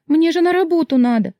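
very high, alarmed voice; sudden realisation. A narrator's reading. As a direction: blurt it out in a fluster. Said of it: "спохватилась я". (спохватившись, встревоженно)

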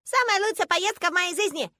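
put-on high, loud childish excitement; elated little girl. A narrator's reading. Girl setting off to save mother, elated. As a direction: burst with joy like a small child.

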